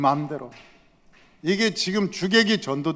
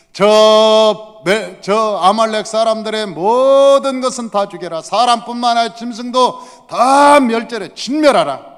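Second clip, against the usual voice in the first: loud, high order of destruction; thundering command; 5 semitones higher, 8 dB louder than usual. Acted. God commands the army before the Amalek war, thundering. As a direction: proclaim a merciless order at full force.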